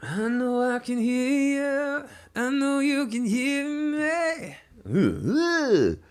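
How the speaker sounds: phlegmy, rattly froggy singing. Real, not acted. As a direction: sing through a froggy, phlegmy throat. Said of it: "I sound like a frog".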